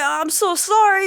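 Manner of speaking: high, loud mock apology; apologetic dad. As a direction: plead as a remorseful father, hammed up. Dad apologising to aged son.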